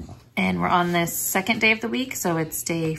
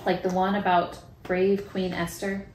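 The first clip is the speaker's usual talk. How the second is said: put-on reading to children; 4 dB quieter than usual. Reads Bible story, prays with child.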